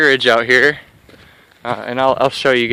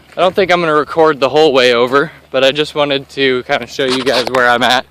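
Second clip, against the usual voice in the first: usual speaking voice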